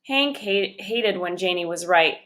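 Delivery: plain reading voice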